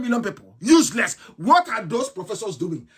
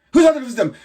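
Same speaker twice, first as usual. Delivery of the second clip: Who's pushed out louder the second time; louder insistent repeat; 3 semitones higher, 6 dB louder, much faster than usual. Real, not acted. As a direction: hammer the question harder. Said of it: "repeated louder".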